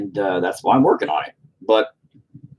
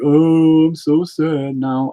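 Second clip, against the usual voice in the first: slow, hammed-up self-pity, higher and louder; mock sad; 7 semitones higher, 5 dB louder, much slower than usual. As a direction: whine it like a fake sob story. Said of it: "This is where I get depressed. I'm like".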